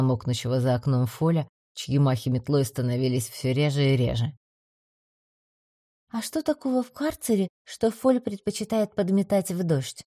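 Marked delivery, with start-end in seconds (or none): 6.1-10.0 put-on young woman voice. A narrator's reading. Голос Адели.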